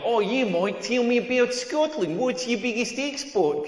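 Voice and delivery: slow, drawn-out Australian impression; Australian accent. Comedian mimics his Australian flatmate asking about Scotland, Australian accent. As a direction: put on a hammy Australian accent, stretching the words. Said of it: "that's exactly what Australians sound like".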